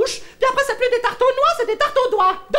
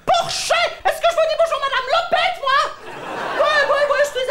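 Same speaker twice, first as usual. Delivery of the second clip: high indignant retort; 5 semitones higher than usual. Acted.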